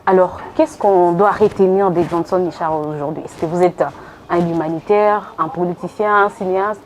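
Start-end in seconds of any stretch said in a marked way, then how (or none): none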